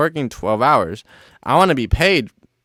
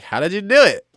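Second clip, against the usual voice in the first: louder and higher, eager coworker; eager question; 10 semitones higher, 7 dB louder than usual. Retold or imitated. Coworker grilling him on the pedestal, loud and eager. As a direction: fire it off like a curious fan.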